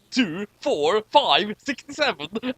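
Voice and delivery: usual speaking voice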